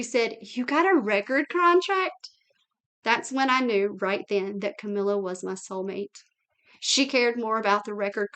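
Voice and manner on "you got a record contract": higher, slow, thrilled question; light up at the news; excited disbelief. Retold or imitated. Camilla thrilled on the phone about the record deal, excited.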